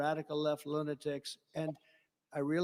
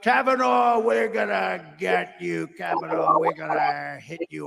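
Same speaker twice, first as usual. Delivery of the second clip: loud, mocking yell of a threat; mock threatening shout; 8 dB louder than usual. Quoting Schumer on the courthouse stairs, mock shouting. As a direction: bark it like a rally bully. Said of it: "or whatever the hell he said".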